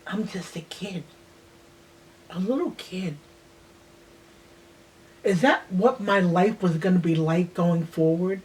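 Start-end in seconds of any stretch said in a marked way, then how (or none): 0.1-3.2 soft hushed whisper; a small voice whispered in pain. Real, not acted. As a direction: barely breathe the words, hurt. Hurt child reflecting on assault.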